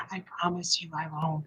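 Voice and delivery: low, hushed ghostly voice, lightly done; a hint of a spooky ghost whispering a promise; haunted voice. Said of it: "That's in my haunted voice".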